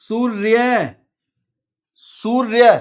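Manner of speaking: सूर्य in two stretched parts, "soor-yay"; sounded-out word. Shown as a demonstration. Teacher asking for the last letter, सूर्य sounded out slowly. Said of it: "सूर्य का last वर्ण कौन सा है".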